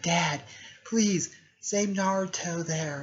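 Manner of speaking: put-on high, slow pleading girl's voice; pleading daughter. Sakura begging her dad to save Naruto, high and slow. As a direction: beg Dad to save him.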